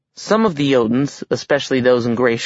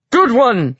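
loud, high mock-jovial shout; 11 semitones higher, 5 dB louder than usual. A narrator's reading. Giant king covering for onlookers, jovial shout. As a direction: call it out for the whole hall. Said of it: "shouted the Giant King".

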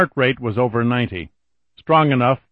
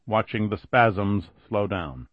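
ordinary delivery

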